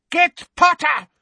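high, loud shriek. A narrator's reading. Bellatrix shrieks at the Death Eaters, high and loud. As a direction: shriek the order. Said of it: "shrieked Bellatrix".

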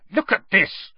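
Harry thrusting the article at Hermione, snarling; snarled. A narrator's reading. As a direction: shove it at her angrily. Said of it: "Harry snarled".

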